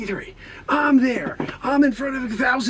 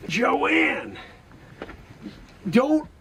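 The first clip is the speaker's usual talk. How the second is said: ordinary talk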